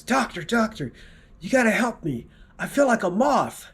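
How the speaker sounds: high, pleading patient voice. Acted. Patient begging the doctor for help, high and pleading.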